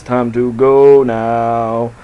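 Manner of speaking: very slow drawn-out words; slow quote. Retold or imitated. Quoting her at one forty-three, very slow line. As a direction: drag every word out. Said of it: "she says".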